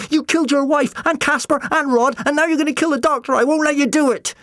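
high, loud, racing accusation; furious yell. A narrator's reading. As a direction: yell it all out in a desperate rush. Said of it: "Jim yelled".

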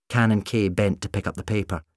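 ordinary delivery, nothing marked

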